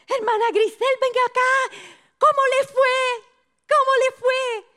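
very high, sing-out summons; eager pastor calling out. Retold or imitated. Pastor Víctor asks how it went, high eager calling. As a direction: call her over in a high, eager voice.

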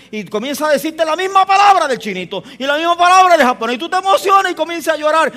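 fuerte, agudo y encendido; predicación a plena fuerza. Real, not acted. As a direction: predicando con todo el fervor. (predicación encendida y alta)

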